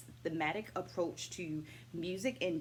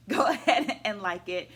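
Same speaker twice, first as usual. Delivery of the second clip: laughing; 4 semitones higher, 10 dB louder than usual. Real, not acted. Laughs urging viewers to like.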